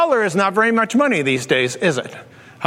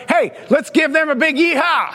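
hyped call; 7 semitones higher than usual. Acted. Pastor rallies the online campuses.

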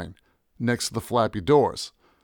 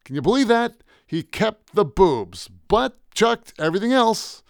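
high-pitched, emphatic outburst; renewed energy; 9 semitones higher, 4 dB louder than usual. A narrator's reading. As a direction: exclaim it in delighted outrage, stressing each word. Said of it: "with a renewed burst of energy".

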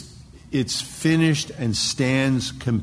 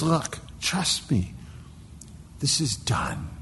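slow, high, whispery insistence; hushed assurance; 6 semitones higher, 6 dB quieter than usual. Acted. Preacher voices God asking for trust, hushed assurance. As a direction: confide it slowly in an intense near-whisper.

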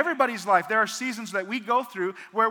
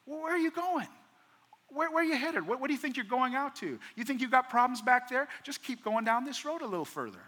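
much higher, probing voice asking where she is going; questioning angel; 5 semitones higher, 4 dB quieter than usual. Retold or imitated. Angel questioning Hagar in the desert: high, probing questions. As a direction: gently challenge her, pitched well up. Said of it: "That's what he does to her".

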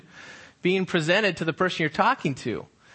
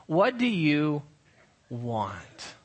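low and quiet, "want" fading almost to nothing; sincere question; 3 semitones lower, 3 dB quieter, much slower than usual. Shown as a demonstration. Pastor posing the sincere question to his listeners, soft and low. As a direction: ask it gently, really wanting to know. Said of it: "the question this morning I wanna pose to you sounds like this".

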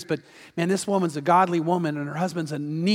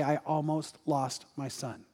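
soft, slow, understated grief; tearful confession; 3 semitones lower, 8 dB quieter than usual. Retold or imitated. Fallen pastor confesses about his son, quiet and sorrowful. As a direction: retell a father's sorrow quietly, slowly. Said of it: "I watched him with tear-filled eyes say".

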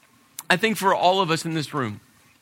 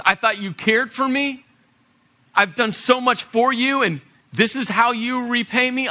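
raised pitch, pleading reproach; wounded, insistent questioning; hurt confrontation; 6 semitones higher than usual. Acted. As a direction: demand answers from a friend who let you down. Acts out a hurt friend confronting.

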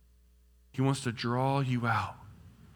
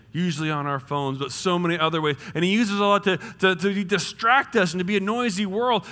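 normal talking voice

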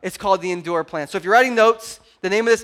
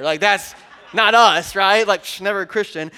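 mock protest; 5 dB louder than usual. Real, not acted. Ironic aside to congregation.

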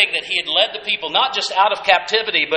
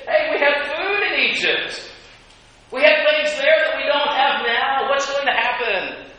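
higher, put-on grumbling crowd voice with a laugh; whiny complaining Israelites; 6 semitones higher than usual. Israelites grumble to Moses about food, higher mocking whine. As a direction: gripe like a hungry crowd wishing for Egypt.

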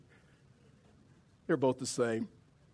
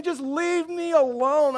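very high, loud mock whine; hammed-up cry of someone at the limit; exasperated plea; 6 dB louder, slower than usual. Overwhelmed man to his pastors, comically exasperated.

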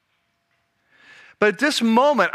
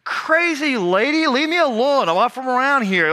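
forceful brush-off; 4 semitones higher than usual. Retold or imitated. Peter blows off the woman who recognized him, forceful brush-off. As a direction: brush the woman off with a raised pitch. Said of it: "You've gotta come on stronger now".

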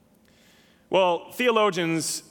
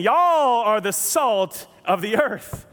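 slow Southern drawl with Y'all stretched; Southern paraphrase; 4 semitones higher, 5 dB louder, slower than usual. Shown as a demonstration. Pastor rephrasing Jesus to the church, Southern drawl. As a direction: read it the Texas way, slow and loud. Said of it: "Jesus is saying".

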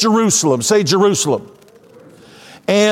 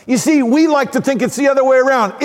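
usual speaking voice

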